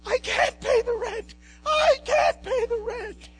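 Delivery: high shrill falsetto damsel, slow and pleading; distressed damsel. Acted. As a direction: wail like a helpless heroine. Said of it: "Bowtie Melodrama".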